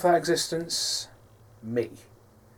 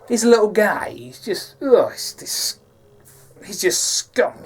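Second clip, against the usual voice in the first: Sicilian Joe; 7 semitones higher, 6 dB louder than usual. Shown as a demonstration. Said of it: "There's a bit, bit of villain in his voice".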